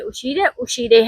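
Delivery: teacher stressing the sh in uscire; slow careful pronunciation. Shown as a demonstration. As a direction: drill the word gently. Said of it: "याद रखे".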